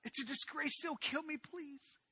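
very high, loud screamed please fading to a broken whisper; screaming plea. Acted. Tamar begs Amnon not to throw her out, screaming and crying. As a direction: scream and cry for mercy. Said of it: "she began to scream and plead and cry".

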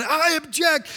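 high, insistent protesting yell; yelled objection. Acted. Attorney defying the judge, yelling. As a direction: yell the objection over the order. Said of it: "he yells".

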